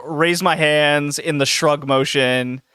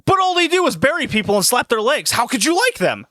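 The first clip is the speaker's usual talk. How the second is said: higher, scoffing fan rant; 9 semitones higher than usual. Retold or imitated. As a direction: scoff at the shopper's taste. Stocker continues rant.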